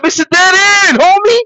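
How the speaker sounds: ordinary talk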